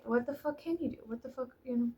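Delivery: ordinary talk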